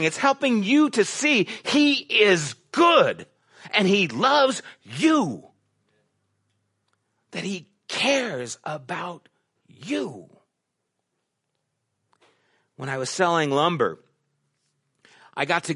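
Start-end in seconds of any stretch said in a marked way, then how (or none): 0.0-10.3 slow tender reassurance. Real, not acted. Preacher stresses God's love.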